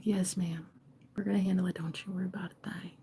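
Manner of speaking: reassuring rescuers. Acted. Divine masculines reassuring the old lady, softly. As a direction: soothe her very softly. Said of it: "So here's these divine masculines. They show up and they're like".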